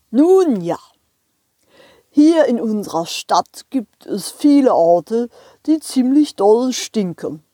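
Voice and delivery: lightly voiced jolly driver; train driver voice. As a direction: chat as the cheerful driver. Train driver explains town.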